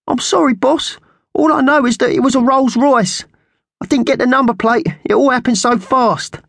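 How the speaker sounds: very high, squawky apology to the boss; apologetic magpie henchman. Acted. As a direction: squawk uneasy excuses at high pitch. Slasher excuses himself to boss.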